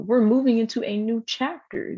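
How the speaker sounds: usual speaking voice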